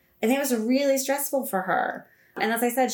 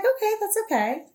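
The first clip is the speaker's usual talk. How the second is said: soothing mom; 12 semitones higher than usual. Retold or imitated. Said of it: "And I was like".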